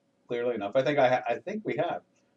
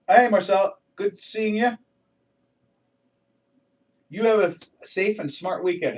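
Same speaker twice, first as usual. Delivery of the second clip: cheery greeting; 7 semitones higher, 6 dB louder than usual. Real, not acted. Greeting a viewer who stopped in.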